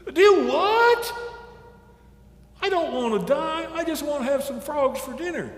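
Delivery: high, loud shocked yelp; squealed disbelief and pleading; alarmed outburst. A narrator's reading. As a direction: burst out in panic, then protest high. Raccoon alarmed at being told to die.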